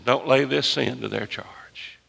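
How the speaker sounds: ordinary talk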